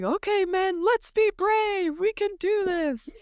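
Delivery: high, hammed-up pep talk; rally the troops, pitched high and eager; rallying leader. Turin rallying his men before the dragon, high hammed-up rally. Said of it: "And he's like".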